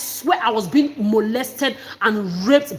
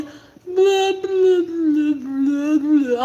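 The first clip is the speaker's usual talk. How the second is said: slow, higher, mushy babbling like a drugged patient; drugged slurred babble; 4 semitones higher, much slower than usual. Shown as a demonstration. Showing viewers how drugged she sounded, slurred slow babble. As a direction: let the words smear into drowsy nonsense. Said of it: "I couldn't talk like this".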